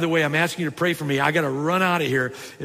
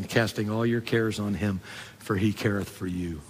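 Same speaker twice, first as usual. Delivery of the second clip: hushed recitation; 8 semitones lower, 6 dB quieter than usual. Retold or imitated. Remembered plaque verse, hushed.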